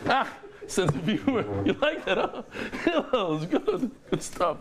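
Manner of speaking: high voice breaking up with laughter; real laughing speech. Real, not acted. Teacher asking if listeners liked that, still laughing. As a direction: talk through the tail of a laughing fit.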